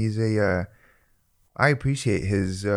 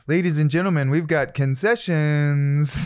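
louder announcer, drawn out slow; trailing announcer; 6 semitones higher, 3 dB louder than usual. Retold or imitated. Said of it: "he trails off on his words like that too".

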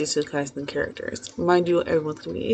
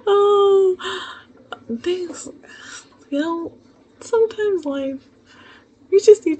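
laughing; 12 semitones higher, 5 dB louder, slower than usual. Real, not acted. Laughing while recovering.

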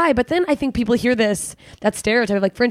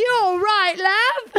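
much higher London accent, imitating; Eleanor Conway accent; 10 semitones higher, much slower than usual. Friend imitating Eleanor Conway, higher London accent. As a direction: mimic her London twang. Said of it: "that's so her accent".

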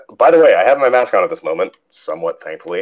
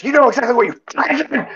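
fast, very high mock screech; imitated losing it; faster than usual. Lady losing it at the man she blames, mock screech. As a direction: squeal it out like someone melting down. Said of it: "she's losing it".

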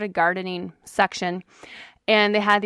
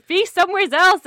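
pleading; 8 semitones higher, 4 dB louder than usual. Retold or imitated. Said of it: "you just keep trying to say".

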